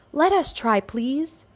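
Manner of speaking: high, pleading little voices; little letters begging. A narrator's reading. Little letters begging the firemen, high pleading voice. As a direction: beg sweetly like small letters. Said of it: "the little letters begged".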